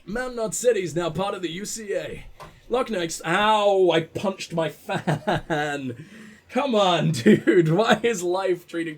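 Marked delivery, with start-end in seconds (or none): 3.2-3.9 sudden pained ow; sharp ow of pain. Real, not acted. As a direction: yelp after hitting the fan. Punched his own fan.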